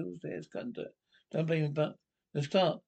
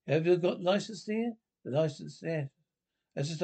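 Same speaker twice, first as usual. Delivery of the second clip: ordinary talk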